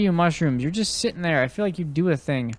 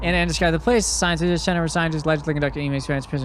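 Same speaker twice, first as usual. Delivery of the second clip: higher-pitched dramatic reading; document read-aloud in put-on voice; 4 semitones higher, faster than usual. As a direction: read out the report in a raised, put-on voice. Continues reading the confession.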